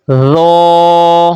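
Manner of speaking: رو said as a heavy "dho", held long; steady drawn-out "dho" for رو, full and unwhistled; thick, no whistle. Shown as a demonstration. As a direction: recite رو with a thick th as in "this". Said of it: "سیٹی کی آواز پیدا نہ ہو".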